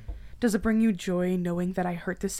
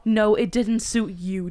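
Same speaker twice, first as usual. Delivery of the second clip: loud, slow bitter repeat; angry echo; 8 dB louder than usual. Acted. Ex-girlfriend mimicking his dismissal, angry. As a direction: throw it back at him. Echoing him angrily.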